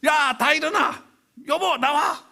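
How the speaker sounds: put-on high, loud, slow drunken bellow; drunk father yelling. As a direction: roar at the sleeping family like a drunk dad. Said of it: "아버지가 와가지고". (잠든 가족을 깨우는 술 취한 아버지, 높고 크게 외치듯)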